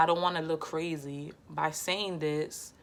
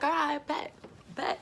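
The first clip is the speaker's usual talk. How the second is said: defiant dare; 11 semitones higher than usual. Retold or imitated. Answering the cousin's threat, high and defiant. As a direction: call back a confident dare. Daring her to come up.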